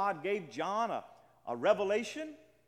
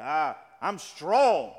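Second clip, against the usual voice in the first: louder, slow, hammed-up bragging; mock boast; 2 semitones lower, 10 dB louder, much slower than usual. Preacher mocking a listener who thinks himself strong: slow, louder mock boast. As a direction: puff yourself up in mock pride. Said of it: "You say".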